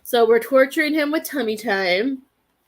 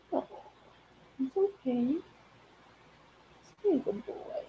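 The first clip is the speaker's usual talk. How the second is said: soothing; 2 semitones higher, 9 dB quieter than usual. Real, not acted. Soothing the dog.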